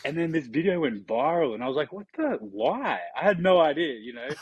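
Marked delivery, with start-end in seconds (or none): 1.9-3.0 mock surprise. Retelling shock at viral video.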